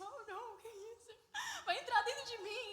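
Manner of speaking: high, squealing comic panic; mock alarm. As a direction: panic playfully, half laughing. Acts out alarm at archaic text.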